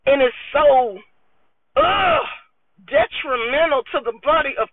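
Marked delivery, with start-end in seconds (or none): 1.8-2.3 a sharp high groan of disgust; high disgusted ugh. Real, not acted. Reacting to church hypocrisy, a high disgusted ugh.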